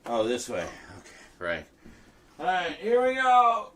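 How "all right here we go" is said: high, loud hype-up; excited. Real, not acted. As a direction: pump yourself up. Hyping himself up.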